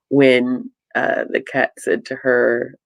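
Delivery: normal talking voice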